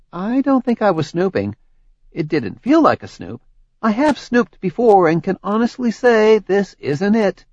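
unbothered and teasing; playfully innocent. Acted. Tori playful deflection.

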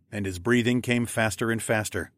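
ordinary delivery

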